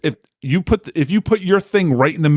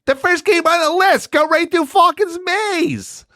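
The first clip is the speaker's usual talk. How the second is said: much higher, slower reread; reading in disbelief; slower than usual. Shown as a demonstration. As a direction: reread it high and slow, amazed. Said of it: "Let me read that again".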